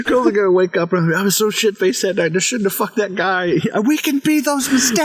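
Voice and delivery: high-pitched, worked-up movie rant; Seth. Retold or imitated. Fan quotes the soccer-field rant, high and increasingly excited. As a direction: mimic a ranting teen, pitch climbing to the last word. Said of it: "with fucking Seth on the field. He's like".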